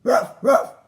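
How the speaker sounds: loud double ruff; dog barking. Acted. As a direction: bark twice, full voice. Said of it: "said Muffin McLay".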